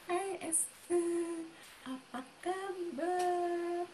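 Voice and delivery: high, soft, very drawn-out greeting; mock-delicate slow hello; ultra-refined slow talker. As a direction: imitate a dainty friend, stretching every word. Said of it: "Kalau dia nggak, gitu".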